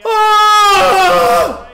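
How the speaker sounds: frustrated shout. Real, not acted. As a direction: yell at the miss. Missed shot.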